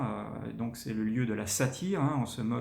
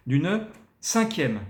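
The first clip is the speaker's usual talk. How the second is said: slow, loud, high "D'une cinquième"; accent and pauses; 4 semitones higher, 5 dB louder, much slower than usual. Shown as a demonstration. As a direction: lean on "D'une cinquième" slowly.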